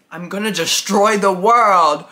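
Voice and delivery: loud villain boast into a cackle; evil villain. Acted. Girl turned evil by a cheese doodle threatens her friend, cackling villainously. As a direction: build to a big, hammed-up evil laugh. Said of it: "I start to laugh evilly".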